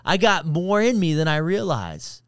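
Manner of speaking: proud inner voice. Pretend. Imagined triumphant self-talk.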